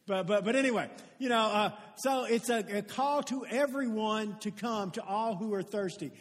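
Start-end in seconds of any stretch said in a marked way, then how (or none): none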